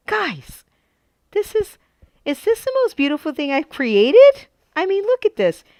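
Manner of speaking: high, thrilled gushing; excited. Real, not acted. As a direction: gush over your best work. Admiring her creation.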